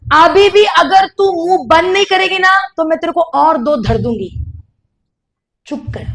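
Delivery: granddaughter threatening the confused granny, a sharp retold outburst; retold angry threat. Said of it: "मैंने उसको बोला".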